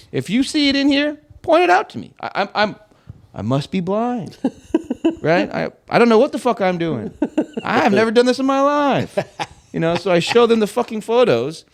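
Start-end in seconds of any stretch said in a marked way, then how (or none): 0.1-9.2 higher, mock-helpless sarcasm; sarcastic plea. To the old-timer techs, mock-helpless higher sarcasm. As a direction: play dumb to make the point.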